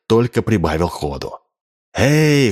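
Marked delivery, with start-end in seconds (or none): 1.9-2.5 long, high-pitched holler; narrator's raised-pitch yell; crowd yell. A narrator's reading. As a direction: call out like a shouting crowd. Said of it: "хором завопили туземцы".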